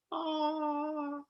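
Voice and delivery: high soft breathy "Aw"; soft awed sigh. Retold or imitated. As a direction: let out a soft sound of awe at the stars. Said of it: "Scorpio is just like".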